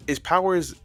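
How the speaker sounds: ordinary talk, nothing marked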